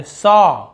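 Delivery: सॉ loud, as "saw"; rounded "aw". Shown as a demonstration. Teacher modelling the word, emphatic "aw" in सॉ. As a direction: round सॉ into a long "aw". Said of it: "सा नहीं बोल रहे".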